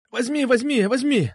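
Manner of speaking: high-pitched hurried squeal; shrieked surrender. A narrator's reading. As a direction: squeal as you give in. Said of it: "взвизгнул он". (взвизгнуть, сдаваясь)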